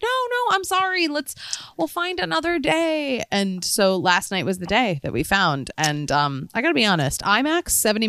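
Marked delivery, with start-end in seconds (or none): 0.0-3.2 much higher, softer apology; apologetic. Retold or imitated. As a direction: coax him with a quick sorry. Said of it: "Then I'm like".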